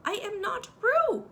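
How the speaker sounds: high, loud and slow insistence; loud protest. A narrator's reading. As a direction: stamp each word out loudly. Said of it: "said Piglet loudly".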